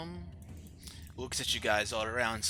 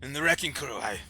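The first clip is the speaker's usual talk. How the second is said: loud, raised royal voice; troubled, demanding king; 6 semitones higher, 7 dB louder than usual. Acted. King to his rescuers about his daughter, troubled and demanding. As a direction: press for answers, high and forceful.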